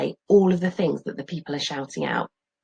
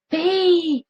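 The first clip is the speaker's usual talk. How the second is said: distant call; 12 semitones higher, 4 dB louder than usual. Acted. Dad calling faintly.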